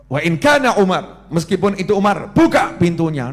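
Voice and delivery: loud, fearless order; bold, commanding. Acted. Prophet tells companions to open the door even for Umar, bold command. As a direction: give the order at full voice like a brave leader. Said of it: "Yo gagah gitu ya".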